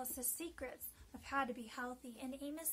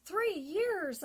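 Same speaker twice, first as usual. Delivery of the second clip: louder astonished repeat; 6 semitones higher, 9 dB louder than usual. Retold or imitated. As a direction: echo it in shock. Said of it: "I said, astonished".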